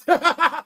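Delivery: short, high, loud burst of laughter; real giggling outburst. Real, not acted. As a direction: crack up briefly. Laughs after repeating his line.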